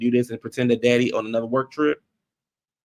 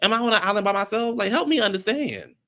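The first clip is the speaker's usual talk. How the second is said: exasperated plea; 8 semitones higher than usual. Real, not acted.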